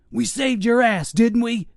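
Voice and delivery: high cocky yell. Acted. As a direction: yell it back at the soldier. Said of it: "he yelled".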